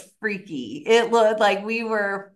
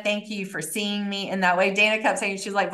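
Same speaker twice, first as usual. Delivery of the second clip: usual speaking voice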